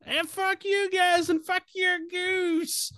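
very high, drawn-out character yell; high-pitched yell. Acted. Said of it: "just yelling out".

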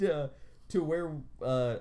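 ordinary talk, nothing marked

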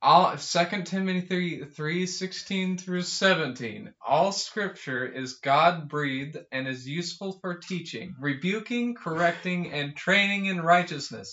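rising fervent verse reading; scripture reading. Acted. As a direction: build it like preaching. Reads a Bible verse.

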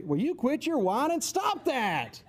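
mock parental irritation, rising in pitch; impatient parent. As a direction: tell off a whining kid. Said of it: "Their reaction is probably".

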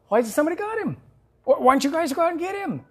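high, excited urging; a boy pleading with King Saul to act; eager young David. Acted. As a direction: press the king with boyish zeal.

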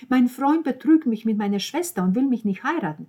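high, loud outraged accusation; worked up. A narrator's reading. Roberta accuses boyfriend and sister, loudly upset. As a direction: blurt out the grievance to the table. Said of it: "regte Roberta sich auf".

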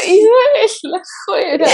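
high laughing protest. Real, not acted. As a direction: laugh through the words. Laughing, sending friend out. (riendo a carcajadas)

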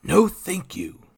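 high, slow forced politeness; smooth it over, slow and polite; recovering composure. A narrator's reading.

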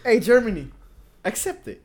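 mock calling out. Calling out to Germany.

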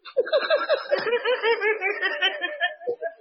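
long high burst of laughter; big laugh. Real, not acted. Laughing hard at his own story. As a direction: crack up at the punchline.

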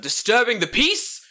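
high, loud, drawn-out indignation; hammed-up affronted complaint, slowly stressed; mock outrage. Man objecting to noisy kids, mock-outraged.

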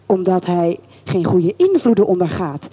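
usual speaking voice

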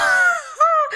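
squealing laugh. Real, not acted.

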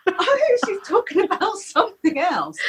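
high, squeaky giggling realisation; laughing through very high-pitched words; retold stifled laughter. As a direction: crack up as it dawns on you. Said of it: "I was howling with laughter".

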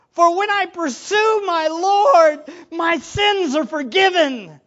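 high, loud passionate preaching; fervent preaching. Acted. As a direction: proclaim forgiveness with fire. Preaching at full force.

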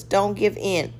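ordinary talk, nothing marked

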